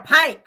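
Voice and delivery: "pink" drawn out with an open "a", like "paink"; pink said as "pank". Real, not acted. Vlogger judging a dress too pink, "pink" said "pank". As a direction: stretch "pink" into a slow, loud "pank". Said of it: "I gotta call it pink".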